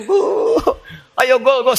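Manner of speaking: high, loud cheering for a goal; mock football cheer. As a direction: cheer like a fan at the stadium. Preacher mimics football cheering.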